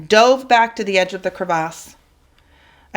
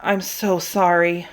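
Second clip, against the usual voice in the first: tearful apology. Acted. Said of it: "hard tears in my throat".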